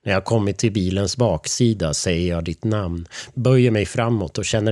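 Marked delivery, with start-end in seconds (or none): none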